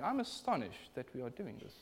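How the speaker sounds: a mild, mannered objection in Parliament; lightly voiced politician tutting; polite parliamentary tone. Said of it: "Paul's not in the House of Commons going".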